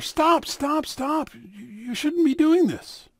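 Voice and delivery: high, loud cry to stop; urgent plea. Acted. Rabbi pleads loudly with the policeman to stop. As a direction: call out to halt the beating.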